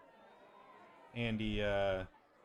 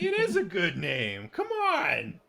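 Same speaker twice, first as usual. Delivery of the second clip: high, loud, cracking with laughter; laughing through it; 10 semitones higher, 8 dB louder, slower than usual. Acted. Andy laughs off Rob's complaint about the team name, high and giggling. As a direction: defend the name while barely holding in laughs.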